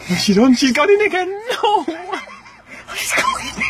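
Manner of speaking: excited disbelief. Real, not acted. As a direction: squeal it in gleeful shock. Prank victim goes back in.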